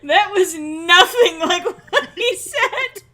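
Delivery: laughing. Real, not acted. Laughing at friend's bad line.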